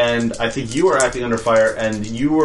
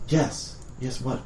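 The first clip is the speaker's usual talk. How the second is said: soft hushed reply; stage whisper; 3 semitones higher, 8 dB quieter, slower than usual. Acted. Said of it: "stage whisper then".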